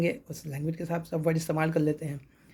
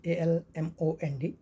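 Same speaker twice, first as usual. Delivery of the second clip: slow letter by letter; spelling out letters; slower than usual. Shown as a demonstration. Teacher spelling almond for students, slowly. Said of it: "almond".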